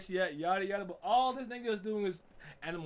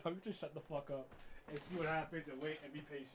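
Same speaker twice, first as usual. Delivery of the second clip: quiet resigned mutter; 2 semitones lower, 11 dB quieter than usual. Retold or imitated. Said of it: "So I said".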